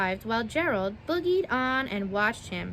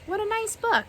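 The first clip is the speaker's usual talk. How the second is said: much higher delighted remark; 10 semitones higher, faster than usual. Real, not acted. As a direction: gush over the book. Reader delighted by book.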